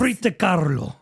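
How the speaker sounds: German-accented name with rolled R; lilting mock German accent; attempted German accent. Shown as a demonstration. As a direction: roll the R in a put-on German accent. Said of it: "I'm just rolling my R's".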